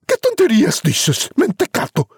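high, dismissive mother voice; scoffing mother. Acted. Mother scoffs at Juan's giant story. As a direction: brush off his nonsense.

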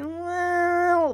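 long, high, drawn-out hedge; mock-hesitant stalling. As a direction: stretch it out reluctantly. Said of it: "The girls hesitate".

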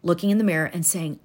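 usual speaking voice

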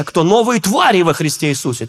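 ordinary delivery

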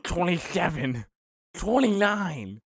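lightly voiced slow big guy counting; big guy counting sheets. Big guy counting toilet squares, slow mock voice. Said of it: "A real big guy's like".

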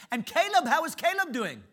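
very high, loud, bursting with excitement; excitable Brother Vic. Retold or imitated. Imitating Brother Vic on the phone, high and loud. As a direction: boom it out like the most excitable guy alive. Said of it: "He said".

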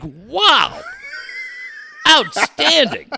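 drawn-out, high, awed wow; amazed wow. Retold or imitated. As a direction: gush in open amazement. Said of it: "You're like".

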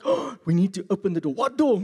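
loud, high, urgent crowd reaction; hammed-up mafia panic; excited movie mobsters. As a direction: play the henchmen scrambling for answers. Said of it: "And everybody's like".

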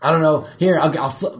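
ordinary talk, nothing marked

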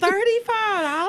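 shocked. Real, not acted. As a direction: pitch it up in outrage.

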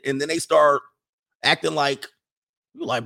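ordinary talk, nothing marked

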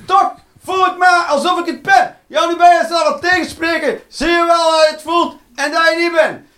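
high, loud back-and-forth arguing; heated inner quarrel. Acted. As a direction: argue furiously with yourself. Acted inner argument with himself. (luide ruzie met zichzelf)

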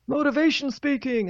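high-pitched motivational speaker impression; put-on motivational speaker voice; motivational video guy. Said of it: "I used to watch this guy".

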